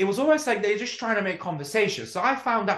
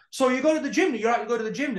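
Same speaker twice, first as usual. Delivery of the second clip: a slightly higher nosy stranger voice; imitating a chatty carver; 7 semitones higher than usual. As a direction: ask it with eager curiosity. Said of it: "he looks at me and he's like".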